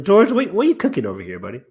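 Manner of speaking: high, playful teasing voice; George impression. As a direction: pitch it up like George calling out. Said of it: "that's your best, uh, George impression".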